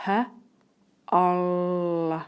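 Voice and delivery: "h" as a short puff, then "ol" held long; phonics-style "h", pause, stretched "ol"; sounding out. Shown as a demonstration. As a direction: split the syllable into "h" and "ol". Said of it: "sounds that I can hear".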